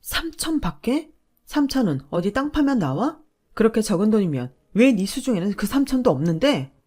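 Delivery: 높고 느리게 되묻다가 큰소리로 쏘아붙이기; 어이없다는 듯 되받아치다 언성을 높여. Acted. 남편 말에 어이없어 반박. (남편의 말을 되받아 어이없어 소리치는 아내)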